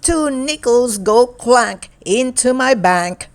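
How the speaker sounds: lively, sing-song picture book reading; reading to children. Acted. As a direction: read it brightly to a young child. Picture book read aloud.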